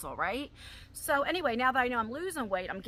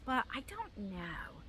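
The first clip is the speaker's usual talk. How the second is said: whispered aside; 9 dB quieter, slower than usual. Real, not acted. Trailing aside, unvoiced.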